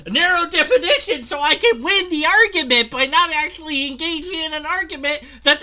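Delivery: high, laughing mock whine. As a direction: lampoon him in a squeaky voice. Mocking opponent, high laughing voice.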